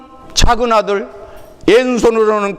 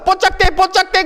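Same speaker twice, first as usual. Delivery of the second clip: high, chirpy Jeolla call of "뽀짝대"; 뽀짝대 in Jeolla dialect, pitched high; 9 semitones higher than usual. Retold or imitated. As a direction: call out the dialect word like a passenger giving directions. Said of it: "그대로 그 말을 쓰시오".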